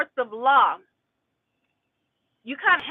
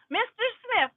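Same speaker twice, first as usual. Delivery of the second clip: high mocking call of the name; sarcastic judge; 8 semitones higher than usual. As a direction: lift the name high, talking down. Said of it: "Sarcastic people".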